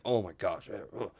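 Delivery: low grumble of mild disgust; mock disgust. Mimicking someone protesting pink in the gym, mock disgust. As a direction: imitate an offended gym-goer grumbling.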